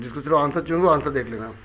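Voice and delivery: ordinary talk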